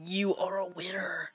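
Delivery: breathy secret whisper; hushed aside. Pretend. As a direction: whisper it to Mario.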